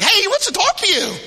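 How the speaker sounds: high, loud call; crowd calling him over. Acted. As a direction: call eagerly to the blind man. Voices the crowd's changed tune.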